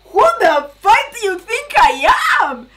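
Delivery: indignant, near-tears outburst; real outraged shout. Real, not acted. Outraged at the TV show.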